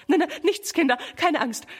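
höher, nervös beschwichtigend; stotternd, erschrocken. A narrator's reading. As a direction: sich selbst und die Kinder hastig beruhigen. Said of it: "stottert Mama". (noch erschrockene Mama beruhigt die Kinder)

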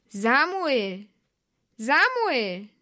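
long, sung-out distant call of the name; God calling. A narrator's reading. God calls the sleeping boy by name, drawn-out distant call.

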